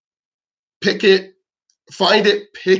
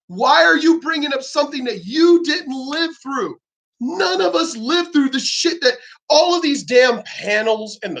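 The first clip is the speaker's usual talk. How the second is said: angry rant; 6 semitones higher than usual. Real, not acted. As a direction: let it fly at them. Ranting at feminists again.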